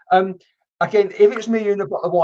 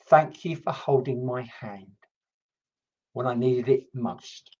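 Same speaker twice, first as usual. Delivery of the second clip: tender message; 5 semitones lower, 5 dB quieter, slower than usual. Acted. Delivering spirit message tenderly.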